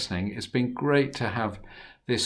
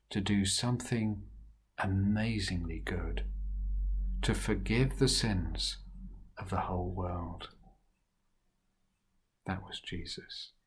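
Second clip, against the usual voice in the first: hushed; 4 semitones lower, 8 dB quieter than usual. A narrator's reading. Reverent close of story.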